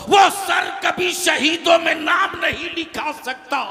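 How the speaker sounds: loud, high, ringing sermon delivery; full-force preaching. Real, not acted. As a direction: preach it out to a big crowd. (पूरे जोश में तक़रीर)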